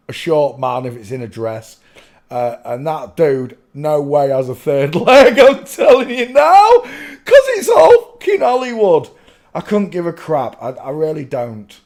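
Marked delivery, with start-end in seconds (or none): 4.9-9.1 high, loud outburst breaking with laughter; laughing rant. Real, not acted. Host dismissing fakes as Hollywood, laughing shout. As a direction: shout your disbelief through the laughs.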